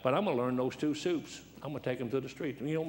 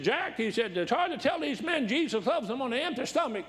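raised, earnest quoting voice; voicing the old preacher's advice, pitched up; quoted mentor; 10 semitones higher than usual. Retold mentor's advice, high voice.

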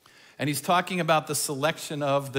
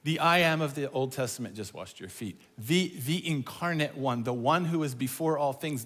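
normal talking voice

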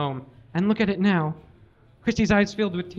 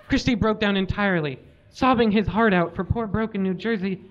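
usual speaking voice

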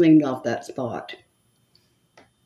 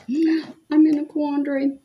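mock dramatic; 10 semitones higher, 3 dB louder than usual. Playful dramatic aside.

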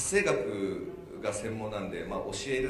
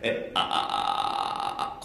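vocal fry; 3 semitones lower, 6 dB louder than usual. Shown as a demonstration. Teacher showing the audience the sound, loud vocal fry. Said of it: "この音です".

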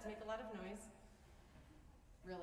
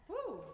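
short, loud high "woo"; high whoop; 11 semitones higher, 6 dB louder than usual. Real, not acted. Host before introducing the program, a high whoop. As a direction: let out a quick high whoop.

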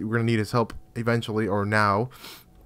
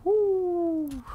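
high victory whoop; excited cheer. Real, not acted. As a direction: whoop high like you just won. Cheering after winning the fight.

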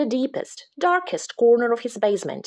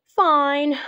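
moaned; 5 semitones higher, 3 dB louder than usual. Acted. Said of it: "moaned Jasper".

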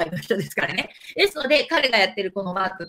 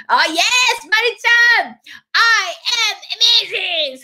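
high, loud cheering chant; 大興奮; 9 semitones higher, 4 dB louder than usual. Real, not acted. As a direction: whoop it out to cheer the viewer on. Cheering a viewer's affirmation. (高い大声で興奮して叫ぶ)

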